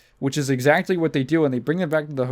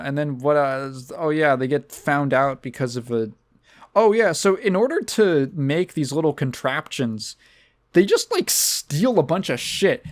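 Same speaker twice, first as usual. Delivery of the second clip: ordinary delivery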